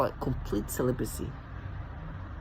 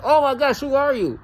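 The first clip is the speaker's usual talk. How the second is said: mock gushing; 11 semitones higher, 7 dB louder than usual. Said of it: "for me to be like".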